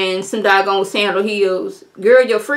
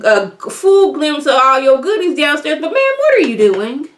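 ordinary talk

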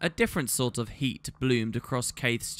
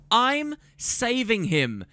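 a higher, slower defiant shout; yelled; 10 semitones higher, slower than usual. A narrator's reading. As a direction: hurl it back at her. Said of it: "Kaith yelled".

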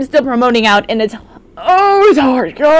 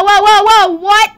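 ordinary talk, nothing marked

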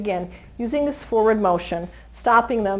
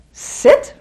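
sharp command; 7 semitones higher, 7 dB louder than usual. Shown as a demonstration. Trainer commanding startled dog.

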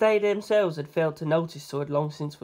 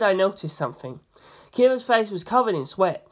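ordinary delivery

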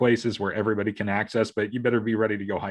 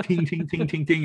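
higher-pitched rapid ting sounds; imitated tinging; 6 semitones higher than usual. Father mimicking his scattered kids bouncing around, quick high tings. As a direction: mimic a fly pinging off glass. Imitating a fly hitting glass.

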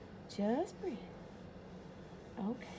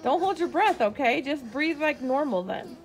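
louder insistent breathing orders; raised urgent instruction; firm raised coaching; 4 semitones higher, 14 dB louder than usual. Real, not acted. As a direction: make her breathe, don't let her hold it. Firm coaching over child's breath holding.

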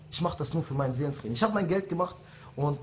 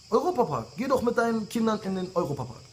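taunting shout, pitched up; 6 semitones higher than usual. Real, not acted. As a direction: jeer it across at the rival. Mocking repeated suggestion to rival.

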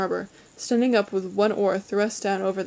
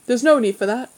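louder, indignant objection; mildly indignant rebuke; 3 semitones higher, 6 dB louder than usual. A narrator's reading. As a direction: object with affronted firmness. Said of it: "Simon said indignantly".

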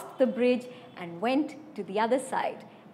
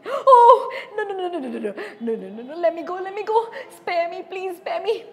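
mock pleading, high and breathy with laughs; begging goat; 7 semitones higher, 4 dB louder, faster than usual. Goat begging the troll to spare him. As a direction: plead for your life, half-laughing.

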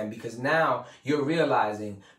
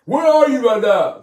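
much higher and louder, a booming hammed-up seminar coach; Tony Robbins impression; 11 semitones higher, 13 dB louder than usual. Mimicking the speaker leading the crowd's visualization, a loud high mock guru voice. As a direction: belt it like a motivational guru onstage. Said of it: "I can't do the Tony Robbins voice".

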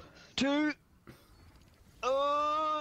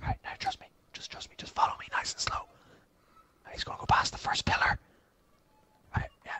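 whispering. Real, not acted. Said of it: "Why were you whispering?".

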